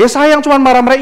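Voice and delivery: loud, high mock protest; grumbling child. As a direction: answer back like a cross kid. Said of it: "satu anak yang marah-marah". (tiruan anak menggerutu keras)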